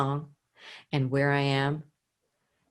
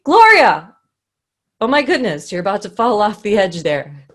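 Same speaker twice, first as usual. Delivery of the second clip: shouted high and loud in alarm; urgent shout; 4 semitones higher, 9 dB louder than usual. Shown as a demonstration. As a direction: yell a warning across the room. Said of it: "It would be".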